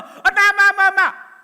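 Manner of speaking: loud, high, insistent calls of mom; child's insistent calling. Retold or imitated. A seven-year-old interrupts his mother's conversation, calling loudly. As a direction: yank her attention with each call.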